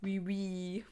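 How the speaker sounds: mock French "oui oui"; playful fake French; French accent. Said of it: "This is a French class now".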